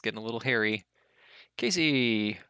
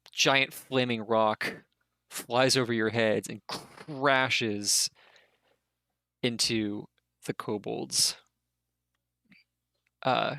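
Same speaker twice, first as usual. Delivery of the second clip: ordinary talk, nothing marked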